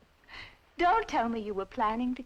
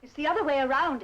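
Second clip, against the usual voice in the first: raised, louder correction in a quarrel; sharp comeback, higher and louder; indignant retort; 6 semitones higher, 6 dB louder, faster than usual. Acted. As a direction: throw it back at the nephew, pitched up.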